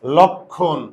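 লক্ষণ said as "lokkhon" with aspirated kh; teacher shows লক্ষণ sounds like lokkhon; pronunciation demo. Shown as a demonstration. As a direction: model লক্ষণ as lɔkkhon, clear doubled kh. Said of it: "এটার উচ্চারণটা কি জানো?".

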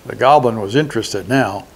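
usual speaking voice

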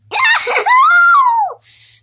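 high screaming laugh, very loud; shrieking laugh. Real, not acted. Kid shrieking with laughter after throwing the enemy, loud. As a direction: burst into a wild shrieking laugh. Game triumph, wild laugh.

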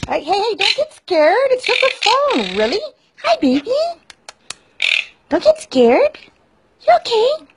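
high soothing voice calming a scared bird; soothing pet talk. Real, not acted. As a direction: reassure the frightened parrot up high.

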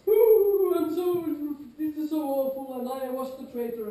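high, theatrical lament as if in character; mock distressed player. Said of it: "they're like".

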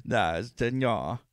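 imitated thick Creole drawl, drawn-out nonsense syllables; mock Creole accent, stretched out with a cackle; thick Creole narrator. Co-host recalling a Scooby-Doo narrator, mock thick Creole accent. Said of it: "like, that thick Creole, and it was like that".

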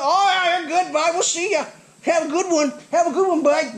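loud, high, hammed-up brush-off; mock breezy seller. Seller waving off buyer's lug nut worry, mock breezy. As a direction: hurry the customer off with exaggerated high goodbyes. Mimicking seller cutting him off with goodbyes.

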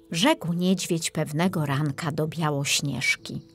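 usual speaking voice

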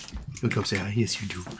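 soft, hushed coo to a pet; cooing to dog. Real, not acted. Asking the dog up to say hi, soft cooing. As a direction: coax the dog gently.

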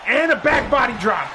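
brisk ringside call, rising with the action; play-by-play commentary. Acted. Commentator calling the match for viewers, energetic play-by-play. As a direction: call the match like a wrestling announcer.

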